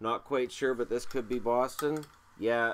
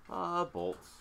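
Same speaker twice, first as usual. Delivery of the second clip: higher, dreamy sigh on Bolts; savouring Bolts; 9 semitones higher than usual. Real, not acted. Collector savouring the word Bolts, higher dreamy sigh. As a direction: sigh the name out fondly. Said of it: "fun to say Bolts".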